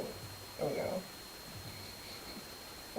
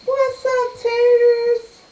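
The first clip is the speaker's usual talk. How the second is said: high, loud sing-song hello; hyped greeting; 10 semitones higher, 7 dB louder, much slower than usual. Real, not acted. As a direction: belt out a big greeting.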